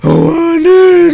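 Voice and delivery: drawn-out, high, loud no; emphatic denial. Acted. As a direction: stretch the word out in protest. Son protests to his mother. (napigsa a panagkuna)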